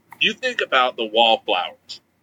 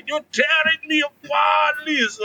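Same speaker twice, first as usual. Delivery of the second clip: Tommy Wiseau impression; 13 semitones higher than usual. Host imitating Tommy Wiseau's famous line, mock Tommy Wiseau shout. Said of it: "I want him to break it with a".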